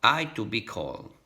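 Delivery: ordinary talk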